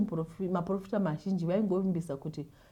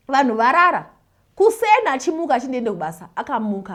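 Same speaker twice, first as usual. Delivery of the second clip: louder and higher, building to the miracle; excited; 7 semitones higher, 12 dB louder than usual. Real, not acted. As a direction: tell the big moment with rising excitement. Climax of testimony, excitedly.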